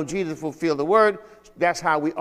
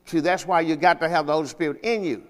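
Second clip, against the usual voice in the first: normal talking voice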